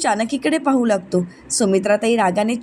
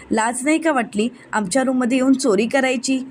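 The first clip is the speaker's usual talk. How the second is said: ordinary delivery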